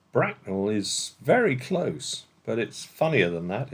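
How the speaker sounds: usual speaking voice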